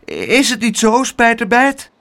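high, faltering question; stammering plea. A narrator's reading. Aunt prompting the naughty boy to agree, high faltering plea. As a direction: appeal to the child, a little flustered. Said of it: "stamelde tante Pleeg".

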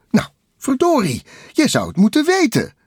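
very high, loud, quick retort; exasperated Takel voice. A narrator's reading. Takel answering Bliksem, high and exasperated. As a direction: fire back in a high-pitched character voice.

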